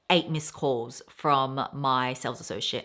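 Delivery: usual speaking voice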